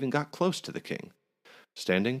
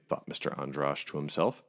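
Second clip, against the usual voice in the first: usual speaking voice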